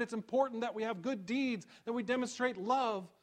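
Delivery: high and loud, pressing challenge; preaching James's words. Acted. Pastor voicing James to the congregation, preaching at full force. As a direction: demand an answer from the pews.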